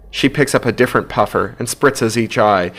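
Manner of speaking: plain reading voice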